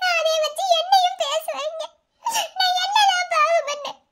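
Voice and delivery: slow, drawn-out tearful lament; crying helplessly, words stretched by sobs; sobbing. Acted. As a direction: wail it slowly, sobs breaking between phrases. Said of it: "please அழாதம்மா".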